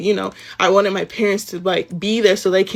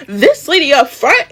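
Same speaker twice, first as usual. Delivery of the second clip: higher, exclaimed mock outrage; indignant, pitched-up quote; scandalized bystander; 6 semitones higher, 4 dB louder than usual. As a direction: imitate a shocked onlooker calling someone out. Imitates the customer's loud scandalized reply.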